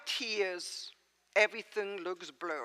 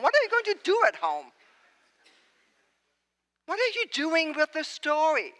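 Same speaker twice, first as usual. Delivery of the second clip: incredulous disbelief; 5 semitones higher, 5 dB louder than usual. Retold or imitated. Preacher voicing incredulous disbelief at disciples.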